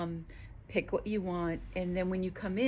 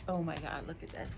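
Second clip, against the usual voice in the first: breathless awed whisper, lower; awed sigh; 3 semitones lower, 8 dB quieter than usual. Real, not acted. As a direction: hush out the words in awe. Finds the owl yarn.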